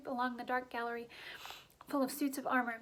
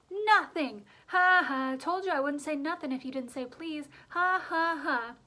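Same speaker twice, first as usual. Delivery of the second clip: gloating cackle; 3 semitones higher, 7 dB louder than usual. A narrator's reading.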